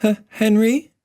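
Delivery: high, tearful little sister stammering his name; a small girl sobbing out his name; little sister crying to her brother on the phone. A narrator's reading. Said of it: "Fanny crying into the phone".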